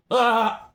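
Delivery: sudden high, loud "ah"; abrupt high scream; demonstrated yell. Shown as a demonstration. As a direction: let out one short loud yelp as a demo. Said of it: "Like that one".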